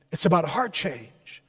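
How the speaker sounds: ordinary delivery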